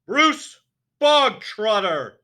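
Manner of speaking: huge, slow, high bellow; furious headmistress roar; barked name. A narrator's reading. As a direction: bark the name across the hall like a drill sergeant. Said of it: "the Trunchbull barked suddenly".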